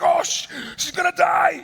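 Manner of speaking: high, shouted mock panic; hammed-up hysterical yell; losing his mind. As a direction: imitate a man freaking out at full volume. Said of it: "he's, like, losing his mind".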